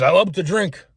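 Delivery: high, loud proud boast; gruff old drinker. Retold or imitated. Old man at the gym boasting, high and loud. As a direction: brag like an old boozer. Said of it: "He goes".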